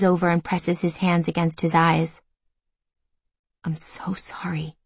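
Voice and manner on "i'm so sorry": slow, breathy whispered apology. Acted. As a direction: whisper it slowly, barely voiced. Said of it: "he whispers".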